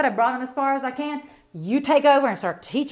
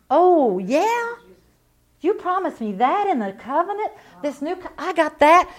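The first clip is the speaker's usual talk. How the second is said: bright, much higher, loud "oh, yeah?"; preacher acting out joyful surprise; delighted discovery; 5 semitones higher, 3 dB louder than usual. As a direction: act thrilled to find the promise. Acts her delighted reply to God.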